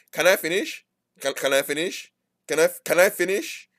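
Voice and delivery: high, pushy "Can I finish?"; sarcastic repeated can I finish; condescending. Retold or imitated. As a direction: talk down to the interrupter. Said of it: "That is condescending".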